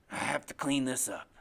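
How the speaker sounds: low, menacing murmur to himself; talking to himself. A narrator's reading. Father plans to himself after his son's accusation, low self-absorbed menace. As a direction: think aloud darkly, deep in the voice. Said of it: "like he's talking to himself".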